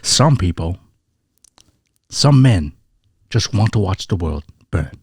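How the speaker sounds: lightly done Cockney Michael Caine; Michael Caine impression. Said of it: "Michael Caine".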